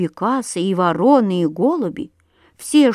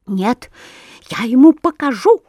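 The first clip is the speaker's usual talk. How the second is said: louder, rising angry sparrow; furious yell; 3 semitones higher, 5 dB louder than usual. A narrator's reading. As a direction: spit it out in a rage. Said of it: "орал Воробей Воробеич, задыхаясь от ярости".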